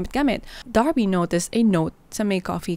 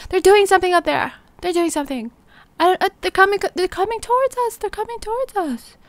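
high, rising panic as they approach; panicked warning; 12 semitones higher than usual. Retold or imitated. Sandy warning friends of approaching attackers, panicked. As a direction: blurt it out, scared and urgent.

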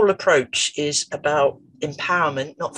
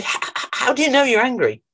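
higher, coaxing therapist voice; gentle curious question; 9 semitones higher than usual. Retold or imitated. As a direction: lift the voice to draw him out.

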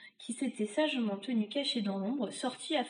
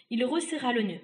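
ordinary delivery, nothing marked

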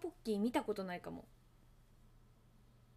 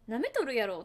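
a light, unconvincing fake Kansai accent; a touch of mock Kansai indignation; fake Kansai dialect; 5 dB louder than usual. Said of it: "今のめっちゃエセ関西弁でしたね".

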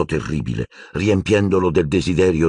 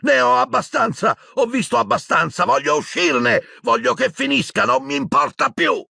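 much higher, sustained furious yelling; shouting. A narrator's reading. Boy wrecking the office, yelling much higher. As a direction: let every line break loose.

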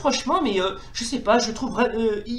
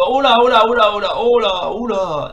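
alarmed oh là; 7 dB louder than usual. Real, not acted. Swarmed by enemies.

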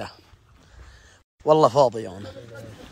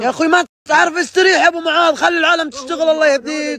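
much higher mock-scolding voice; high teasing voice; 9 semitones higher than usual. Friend teasing Abu Muath in a high voice. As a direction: chide in a high, playful voice.

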